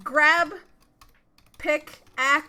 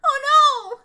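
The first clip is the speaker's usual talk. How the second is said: high, loud shriek of alarm; real shocked outburst, much higher and louder; horrified cry; 10 semitones higher, 9 dB louder than usual. Real, not acted. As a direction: yelp as the monster grabs you. Dog catches the player.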